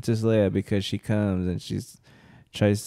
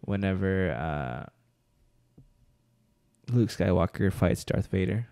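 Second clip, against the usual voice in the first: normal talking voice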